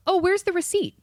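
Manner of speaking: high, sweetly fake question; sarcastic sweet-talk to the customer; mock innocent. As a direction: play dumb in a high voice.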